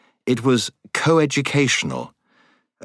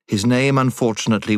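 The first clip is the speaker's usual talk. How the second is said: plain reading voice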